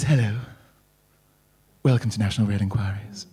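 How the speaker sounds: creepy automated voice. Retold or imitated. Automated phone line greeting the caller, low creepy recording. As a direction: purr it like a sinister phone robot. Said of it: "the woman who says".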